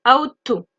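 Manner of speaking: "ought to" said aut-o, no G or H sounded; pronouncing ought to. Shown as a demonstration. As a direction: say "ought to" with just the T. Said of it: "on ne prononce pas le G et le H".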